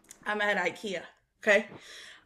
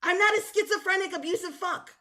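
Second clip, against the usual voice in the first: high indignant rant; 7 semitones higher than usual. Retold or imitated. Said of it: "And I'm always like".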